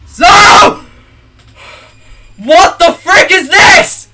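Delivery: high, loud horrified yelling; real panicked shouting, dragged out on this; shocked shout. Real, not acted. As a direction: scream at the screen in disbelief. Shock at game horror scene.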